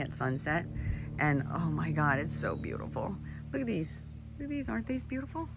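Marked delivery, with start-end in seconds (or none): 3.5-5.5 high, soft, gushing delight; delighted cooing. Real, not acted. Gardener showing viewers her primroses, high delighted coo. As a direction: coo over the flowers like they're precious.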